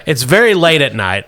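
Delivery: usual speaking voice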